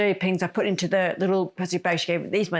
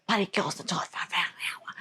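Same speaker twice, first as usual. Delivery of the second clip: hushed, slowing retold whisper; whispered thought; 6 dB quieter than usual. Leaving the bathroom sheepishly, retold furtive whisper. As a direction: whisper it furtively under your breath.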